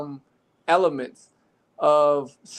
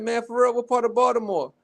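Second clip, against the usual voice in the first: higher, mock-curious question; playing dumb; 7 semitones higher than usual. Quizzing the kid, feigning naive interest. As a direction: pretend to be impressed and clueless. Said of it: "So I started to play a little dumb".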